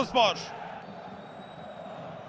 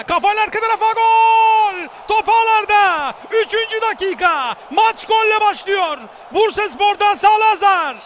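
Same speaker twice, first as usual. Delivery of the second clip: screaming football commentator; 3 semitones higher, 4 dB louder than usual. Real, not acted. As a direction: roar the goal to the crowd. Goal call in match commentary.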